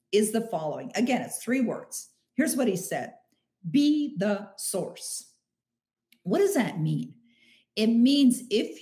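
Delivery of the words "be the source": each word set down slowly and firmly; Be, the, source spaced out, slow and deliberate; slow, weighty mantra. Retold or imitated. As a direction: deliver it like a motto to remember. Quoting a three-word mantra.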